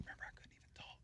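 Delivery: unvoiced whisper of a man without a voice. Recalling his voiceless year to a friend, whispered. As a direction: breathe the words with no voice at all. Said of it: "I had to whisper".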